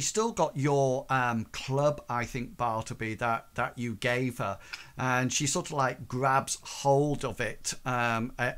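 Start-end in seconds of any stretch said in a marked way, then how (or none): none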